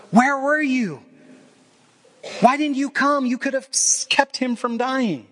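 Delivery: high, pained plea of a grieving sister; a grieving sister's high, reproachful plea to Jesus; pleading Mary. Retold or imitated.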